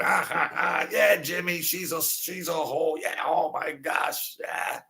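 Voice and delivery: high, mocking cackle of the guys teasing; high jeering mimicry of the guys laughing. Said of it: "And they're laughing".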